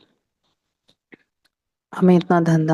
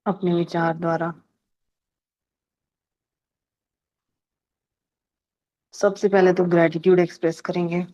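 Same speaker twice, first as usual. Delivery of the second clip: unhurried phrases with long silences between; guided meditation. Real, not acted. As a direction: lead a calm gratitude meditation, pausing after each phrase. Leads a gratitude meditation. (ठहर-ठहर कर शांत ध्यान निर्देश)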